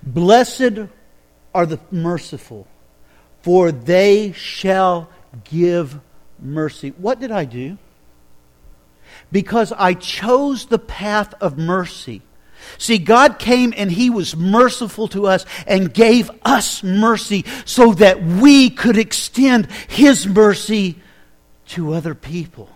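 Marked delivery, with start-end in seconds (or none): 0.0-6.9 scripture recitation. Acted. Quoting scripture, slowed. 9.3-22.7 slow preaching emphasis. Acted.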